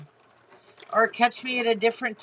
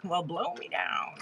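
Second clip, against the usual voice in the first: Popeye voice; 4 semitones lower, 8 dB quieter than usual. Retold or imitated.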